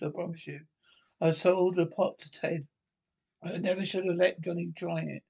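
ordinary talk

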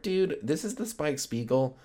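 high, slow, amazed at the trailer; awed surprise. Real, not acted. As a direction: stretch it out like a starstruck fan. Seeing the remake trailer.

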